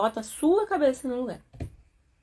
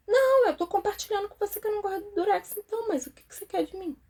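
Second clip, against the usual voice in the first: high, insistent imitated complainer; mock exasperated protest; 10 semitones higher, faster than usual. Complainer explaining to an unmoved friend, mock exasperated. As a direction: protest high, as if not being heard. Retold quarrel over sticky tape.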